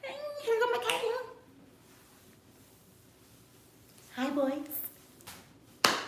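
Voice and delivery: very high, soft put-on voice; hammed-up soft, high-pitched greeting; playful flirty persona.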